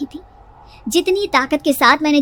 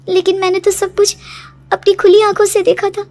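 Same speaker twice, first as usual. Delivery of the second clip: higher, louder, insistent protest; upset, bewildered raised voice; distressed disbelief; 6 semitones higher, 4 dB louder than usual. Acted. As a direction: insist fearfully that it was real. Daughter disbelieves it was a dream.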